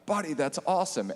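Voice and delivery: high, bright burst of joy; thrilled. Retold or imitated. Teacher hearing his student got a donor, thrilled. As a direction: cheer the news for him.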